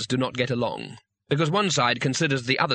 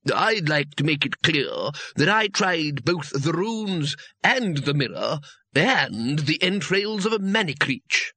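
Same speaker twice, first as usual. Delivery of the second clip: each one tries to sound grander; boasting wizards; 3 semitones higher than usual. A narrator's reading. Wizards competing over heroic failures, mildly pompous voices.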